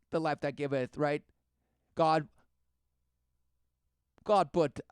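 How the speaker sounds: high, loud sermon-like rant; fervent preaching. Acted. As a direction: preach it in insistent, punched short phrases. Mock sermon rant about the flesh.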